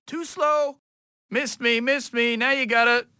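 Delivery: high, teasing schoolyard taunt; playful taunting. Acted. Taunting a foe who keeps missing.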